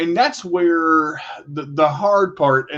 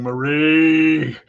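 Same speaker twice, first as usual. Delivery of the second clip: playful drawn-out teasing call; lightly mock-taunt as a forgotten cup; taunting dirty cup. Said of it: "it's taunting you, going".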